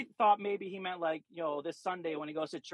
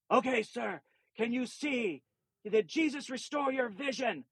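imitating an insistent faith healer, pitched up; intense faith healer; 6 semitones higher than usual. Healer asking the blind man if he can see, imitated intensely. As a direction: quote the healer's raised, expectant questions.

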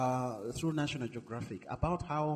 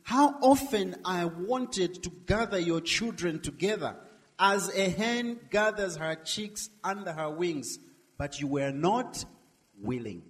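slow, raised pleading lament; crying out; 4 semitones higher, 4 dB louder than usual. Acted. Jesus crying out to Jerusalem's people, slow and pleading. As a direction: plead with them, slow and loud, falling at the end. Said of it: "Jesus is crying out to them".

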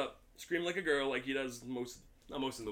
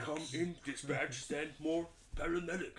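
zombie voice; 5 dB quieter than usual. Acted. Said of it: "and he's like".

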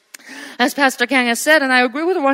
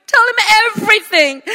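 tearful urging; 5 semitones higher than usual. Real, not acted. Preacher urging, voice breaking.

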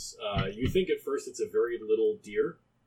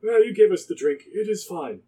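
lightly put-on higher voice, measured words; a hint of a borrowed voice, pitched up; Elk imitation; 6 semitones higher, 4 dB louder than usual. Talking animal answers the party in a lightly mimicked Elk voice. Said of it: "As he switches back to Elk".

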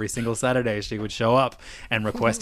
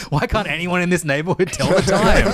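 mock outrage; 7 semitones higher, 7 dB louder than usual. Mock complaint, laughing.

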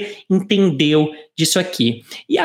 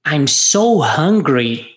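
slow, clear model line; drawn out so students can copy; slow repeat-after-me; 3 semitones lower, much slower than usual. Shown as a demonstration. Teacher giving a line to repeat, slowly. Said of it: "Vamos repetir?".

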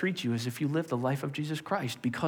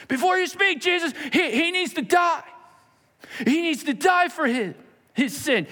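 high, loud accusing shout; angry accuser; 14 semitones higher, 6 dB louder than usual. Acted. Accuser shouting down Jesus in court, high and loud. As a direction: cut him off, demanding death, high and loud.

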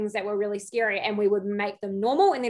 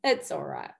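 low, soft, patronizing reassurance; mock patronizing comfort; 7 semitones lower, 5 dB quieter than usual. Bryce comforting his stuck drunk dancer, mock patronizing. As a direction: drop low and soothe condescendingly. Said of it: "tapped my bum real patronizing".

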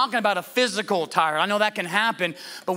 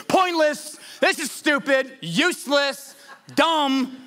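high-pitched exasperated rant; fed-up complaint, pitched up and punched word by word; frustrated Solomon; 5 semitones higher than usual. Acted. Preacher voicing Solomon having one of those days, frustrated. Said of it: "Can you hear the frustration in Solomon's voice?".

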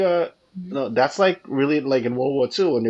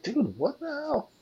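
much higher, disbelieving squeak; incredulous outburst; 13 semitones higher, 7 dB quieter, slower than usual. Real, not acted. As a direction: rise up in mock outrage. Indignant at the writers.

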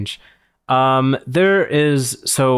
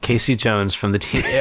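normal talking voice

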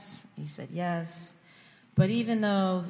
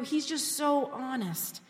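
ordinary delivery

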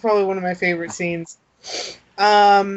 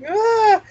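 high mock princess scream; girly shriek; 13 semitones higher, 4 dB louder than usual. Storyteller mimics the princess's dainty shriek. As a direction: squeal like a pampered princess. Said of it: "she's just like".

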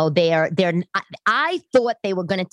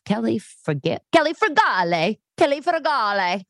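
mock Italian, rolling "Kelly Frugale", rising higher; 6 semitones higher than usual. Italians repeating roommate's name in an Italian lilt. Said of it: "they were like".

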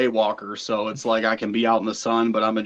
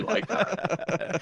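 laughing; 9 semitones higher, 7 dB quieter than usual. Real, not acted. Joking about his metal beard.

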